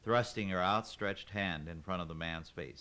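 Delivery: ordinary delivery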